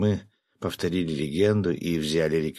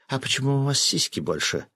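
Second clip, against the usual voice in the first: higher voice of a displeased woman; cooing female voice; 6 semitones higher than usual. A narrator's reading. Fisherman comparing new bodies, cooing female voice. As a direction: coo the complaint in a woman's pitch. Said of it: "проворковал женским голосом рыбак".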